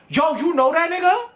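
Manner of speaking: high, pushy jealous demand; jealous challenge. Retold or imitated. Jealous boyfriend confronts him.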